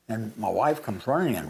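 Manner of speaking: ordinary delivery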